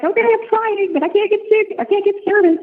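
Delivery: high, exasperated voice; frustrated quote. Retold or imitated. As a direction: sound stuck and frustrated. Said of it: "I was like".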